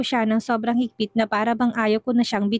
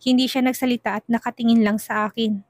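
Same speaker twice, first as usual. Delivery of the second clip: ordinary delivery, nothing marked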